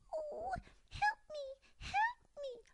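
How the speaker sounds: very high squealing dormouse; a tiny mouse crying for help. Acted. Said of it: "she squealed".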